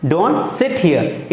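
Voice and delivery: teacher modelling a prohibition; slow firm command. Shown as a demonstration. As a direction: lean on Don't, slowly. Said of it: "ठीक है".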